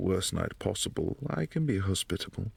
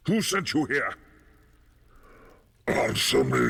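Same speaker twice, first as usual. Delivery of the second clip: sudden loud, high angry outburst; angry bark, much louder; furious demand; 4 semitones higher, 6 dB louder than usual. Acted. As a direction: shout the demand at the intruder. Host suspects trickery, demands answers.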